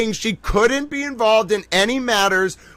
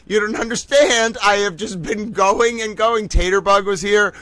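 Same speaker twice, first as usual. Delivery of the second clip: ordinary talk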